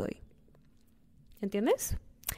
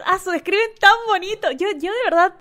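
high, loud gush of delight; gushing, thrilled and high; overjoyed squeal; 11 semitones higher, 7 dB louder than usual. Real, not acted. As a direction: squeal with real excitement. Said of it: "me emociono".

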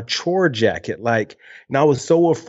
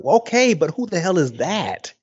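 high-pitched, baffled comeback; acted bewilderment; 6 semitones higher than usual. Young host answering stock TV advice, acted bewilderment. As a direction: push the pitch up in mock confusion. Acts out own reaction to the advice.

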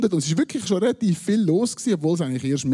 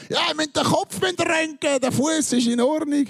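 much higher joking call; calling out from behind; 12 semitones higher than usual. Retold or imitated. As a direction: yell it across the room, joking. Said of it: "ruft er hinten an".

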